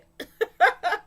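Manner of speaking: high delighted laugh. Real, not acted. Laughing at a viewer's Jeopardy-style answer. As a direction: laugh with glee.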